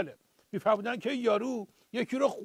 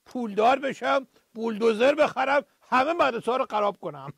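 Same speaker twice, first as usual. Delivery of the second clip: usual speaking voice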